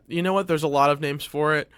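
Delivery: ordinary talk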